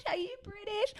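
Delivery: high, hushed mock astonishment; softly gushing imitation of amazed strangers; mock amazement. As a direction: imitate starstruck strangers, pitched high and soft. Strangers' amazed reaction, acted out.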